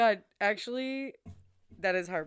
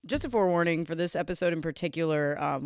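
usual speaking voice

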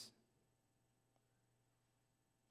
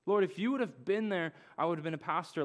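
pleading lament; 6 semitones higher than usual. Retold or imitated. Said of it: "I was saying".

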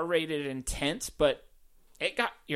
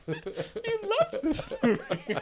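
high, laughing tease; 6 semitones higher than usual. Real, not acted. Laughing with the co-host about the movie, laughing. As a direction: talk through real laughter.

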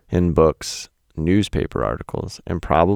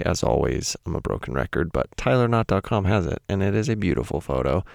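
ordinary talk

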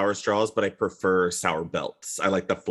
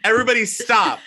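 everybody stop, high and loud; loud high call; 12 semitones higher, 5 dB louder, faster than usual. Retold or imitated. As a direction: call the room to a halt. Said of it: "they're like".

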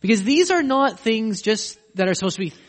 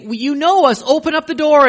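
loud, higher, begging to be let in; pleading; 9 semitones higher, 7 dB louder than usual. Acted. Shut-out crowd begging the owner, loud desperate pleading. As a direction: bang on the door and beg.

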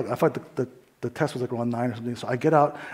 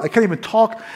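ordinary talk

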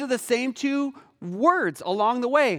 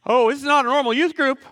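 fast, high, loud mock panic; hammed-up startled reaction; alarmed realization; 4 semitones higher, 6 dB louder, faster than usual. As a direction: play up sudden alarm for the story. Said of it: "I'm like".

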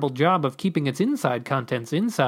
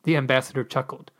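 ordinary delivery

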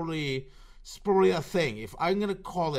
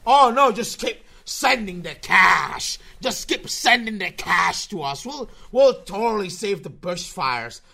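mock charity voice; 4 semitones higher, 7 dB louder than usual. Mocking greedy charity's pitch.

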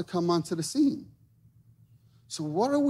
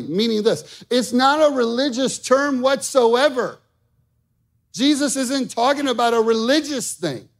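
high, loud, driving sermon voice; preaching at full force; 5 semitones higher, 4 dB louder than usual. Acted. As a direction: preach it hard to the room.

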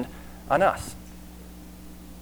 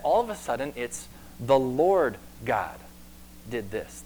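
ordinary delivery

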